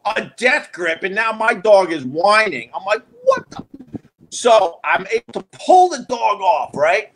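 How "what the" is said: very high, cut-off exclamation; high-pitched cry of outrage; shocked outburst. Acted. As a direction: act out alarmed disbelief. Dog caught in a death grip.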